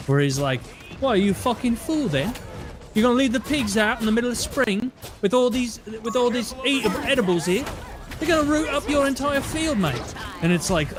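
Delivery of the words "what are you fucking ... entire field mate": high-pitched mock British farmer; British farmer accent. Mimicking Clarkson's young farm helper, exaggerated British accent. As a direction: imitate a young British farmer grumbling about the pigs. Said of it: "say farmer shit in a British accent".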